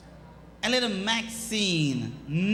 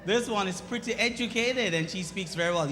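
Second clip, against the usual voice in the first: usual speaking voice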